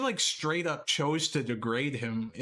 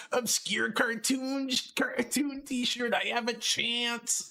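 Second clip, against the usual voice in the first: high, slow, teasing parody of a hopeful guy; mocking imitation; 10 semitones higher, slower than usual. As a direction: mimic him sweetly to mock his hopes. Mocking Preston's hopeful words.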